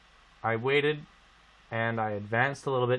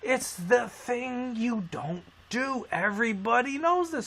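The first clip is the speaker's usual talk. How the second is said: emphatic rant; 9 semitones higher than usual. Real, not acted. Ranting to friends about texting drivers, high and emphatic. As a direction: hammer home the obvious rule.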